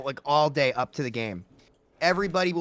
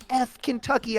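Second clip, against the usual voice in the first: mock scream; 9 semitones higher than usual. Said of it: "she was like".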